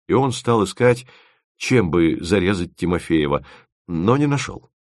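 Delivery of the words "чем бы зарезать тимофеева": plain reading voice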